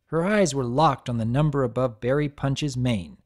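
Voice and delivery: plain reading voice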